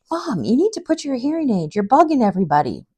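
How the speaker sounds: exasperated daughter imitation, starting high; retold nagging of a parent; mock scolding. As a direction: playfully scold mom, pitch swooping down. Retells daughter scolding her mom.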